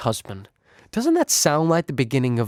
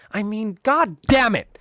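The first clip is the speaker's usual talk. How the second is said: higher and slow, exasperated curse; a higher, slowed-down burst of exasperation; exasperated outburst; 7 semitones higher, slower than usual. Real, not acted. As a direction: vent the outrage, drawing it out. Outraged at the receptionist.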